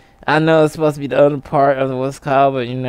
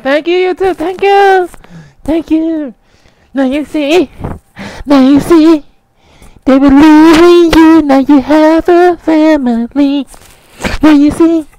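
high, loud, wailing mock-tearful thanks; dramatic; 12 semitones higher, 7 dB louder, slower than usual. Creator accepting into YouTube family, hammed-up dramatic. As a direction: build to a drawn-out overwrought wail. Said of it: "gonna have a dramatic YouTube video".

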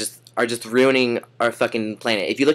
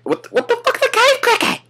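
high, loud falsetto disbelief; a comic high-pitched outburst; incredulous; 5 dB louder than usual. Acted. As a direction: squeal the question in disgust. Disgust at cave crickets.

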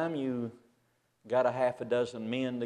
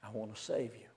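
gentle whispered; 2 semitones lower, 11 dB quieter, faster than usual. Retold or imitated.

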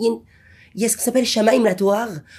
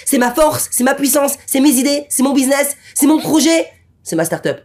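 higher, louder smug bragging; mock boast; 7 semitones higher, 4 dB louder than usual. Preacher mimicking an arrogant rich man, boasting. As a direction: puff up like a proud self-made man.

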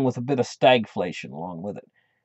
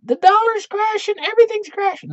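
hammed-up, much higher, louder squeal; mock gloating economists yelling; 5 dB louder than usual. Economists jumping up and down, hammed-up high gloating yell. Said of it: "jump up and down a few times and yell".

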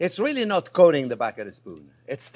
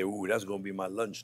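low, soft mock hungry coworker; quiet low imitation of a hungry helper; Antonio; 10 semitones lower, 11 dB quieter than usual. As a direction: teasingly voice a colleague eyeing food. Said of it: "He's gonna say".

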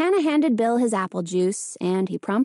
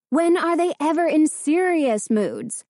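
demanding; 6 semitones higher, 4 dB louder than usual. Acted. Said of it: "her mother demanded".

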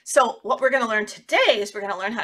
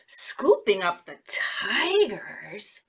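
slow, hushed story whisper; 3 semitones lower, 4 dB quieter, much slower than usual. Acted. Teacher whispering the scary part to children. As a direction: whisper it slowly in suspense.

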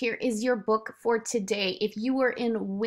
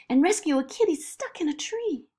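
put-on high-pitched eager girl; Zoe, excited child; 9 semitones higher, 4 dB louder than usual. Zoe planning a kitty rescue, excited. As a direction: carry on excitedly in a child's higher voice.

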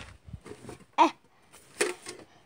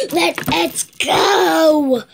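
rallying shout; 3 semitones higher, 6 dB louder, much slower than usual. Acted. Hero rallies with a shout.

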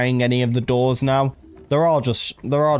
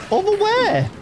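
scared horror game streamer. Real, not acted. Repeated, falling.

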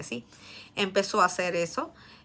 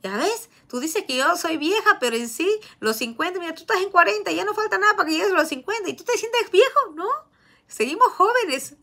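higher, louder mock sister-in-law; 10 semitones higher, 4 dB louder than usual. Sister-in-law teasing the husband about turning fifty, mock voice. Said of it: "como que mi cuñada dice".

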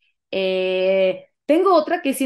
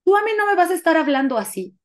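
higher-pitched imitation of an outraged partner; hysterical scolding; 8 semitones higher, 4 dB louder, faster than usual. Partner brakes the car and scolds her, hysterical mock outrage. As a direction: act out an affronted telling-off. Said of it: "Se puso histérico".

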